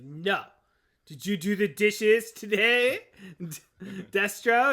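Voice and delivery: nagging partner, loud and drawn out; slow, high, loud demand; Baroness. Acted. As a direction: scold him slowly at full volume. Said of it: "And she's like".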